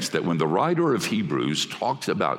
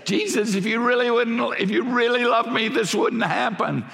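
whiny reproach to Jesus, pitched way up; doubting complainer; 14 semitones higher than usual. Retold or imitated. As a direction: play the doubter accusing Jesus. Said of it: "this one who says".

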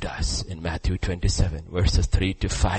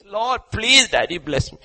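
high, pleading imitation of a crying man; mock crying plea; 14 semitones higher, 5 dB louder than usual. Preacher acting grown man crying for a blessing, mock plea. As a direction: beg daddy, lightly tearful. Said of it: "fifty-year-old man crying".

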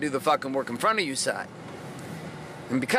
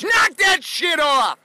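loud, slow, higher shout; shouted command; 12 semitones higher, 7 dB louder, much slower than usual. Real, not acted. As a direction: bark it at the listener to wake them up. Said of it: "And here is the challenge".

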